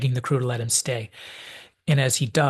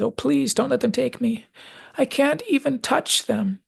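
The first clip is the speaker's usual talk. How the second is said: high, pleading final words; Charlie's tragic plea; 11 semitones higher than usual. Retold or imitated. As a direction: beg for your life as a terrified boy. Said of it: "Charlie's tragic final words".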